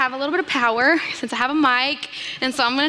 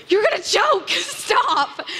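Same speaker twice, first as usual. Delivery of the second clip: mock shout; 7 semitones higher, much slower than usual. Yelling at mom cramming crackers.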